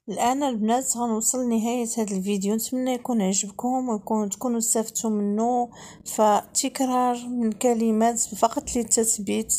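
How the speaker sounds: usual speaking voice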